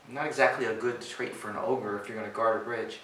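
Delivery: ordinary delivery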